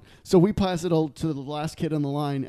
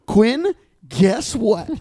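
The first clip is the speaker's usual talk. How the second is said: drawn-out, higher eager mom; 6 semitones higher, 6 dB louder, much slower than usual. Retold or imitated. Mom with trial news for her kid, eager. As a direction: stretch it out like juicy gossip. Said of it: "she'd be like".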